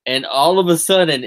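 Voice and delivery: usual speaking voice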